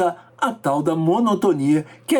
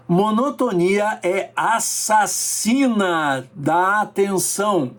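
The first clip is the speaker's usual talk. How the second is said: slow emphasis; slower than usual. Shown as a demonstration. Stresses the first rule, slowly.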